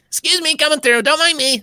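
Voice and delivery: high, sing-out apology; hurried excuse. Acted. Character pushing past people, high hurried excuse. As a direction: squeak your way past in a high voice.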